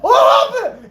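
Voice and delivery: high mock-panicked shout; retold freak-out. Patient smacked with a rag, freaking out. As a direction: blurt it out in comic alarm. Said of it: "Just freaking out".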